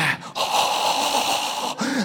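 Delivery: long airy exhaled whoosh; tumbleweed wind noise. Retold or imitated. Mimicking the awkward tumbleweed moment for the audience, long airy whoosh. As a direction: imitate an empty wind blowing.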